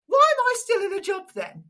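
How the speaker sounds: high-pitched imitation of disbelief; mock incredulity. Announcer hearing of her radio job, comically incredulous. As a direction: act out a colleague's comic outrage.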